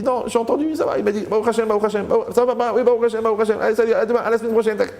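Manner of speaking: high-pitched mock phone chat; breezy phone chat. Son brushing off a parent's questions on the phone, high-pitched breezy chatter.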